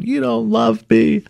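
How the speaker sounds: high, slow whiny wail; childish breakdown. Retold or imitated. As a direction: replay a teen's tearful meltdown. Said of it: "I remember having a breakdown".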